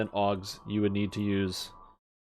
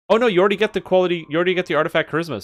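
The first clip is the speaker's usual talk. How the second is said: much higher, louder, fast rush of words; excited realization; 7 semitones higher, 7 dB louder, much faster than usual. Real, not acted. Streamer realizing the set already has the stats, fast excited rush. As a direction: it clicks all at once, blurt it out quickly.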